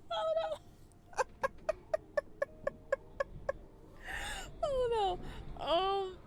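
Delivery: cringe-laugh at your own boldness; laugh-crying. Real, not acted. Streamer laugh-crying over the risky choice, high and squealing.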